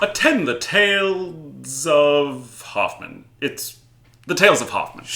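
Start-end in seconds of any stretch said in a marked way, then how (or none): 0.0-3.2 slow, grand and theatrical; dramatic announcer. Pretend. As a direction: proclaim it like a stage herald. Mock grand opening announcement.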